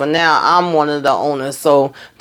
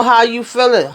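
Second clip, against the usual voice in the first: laughing through it; 7 semitones higher than usual. Retold or imitated. Phone call, grandma coughing.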